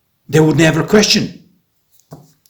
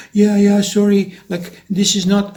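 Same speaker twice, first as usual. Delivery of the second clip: nervous reply; 8 semitones higher than usual. Retold or imitated. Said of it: "couple answers nervously".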